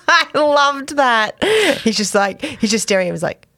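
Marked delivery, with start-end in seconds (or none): 0.1-1.4 laughing. Real, not acted. Laughing after quote.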